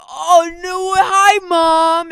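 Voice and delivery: loud, high, thrilled mock yell; hammed-up giddy cry to the camera; excited wave to mom. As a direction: shout it like you just spotted yourself on TV. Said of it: "and they're like".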